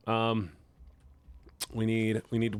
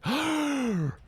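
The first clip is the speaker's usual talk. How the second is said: excited gasp. Retold or imitated. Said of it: "And I'm like".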